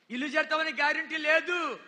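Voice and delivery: loud, urgent sermon delivery; fervent pulpit preaching; full-force preaching. Acted. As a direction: preach it hard to the crowd. Preaching warning.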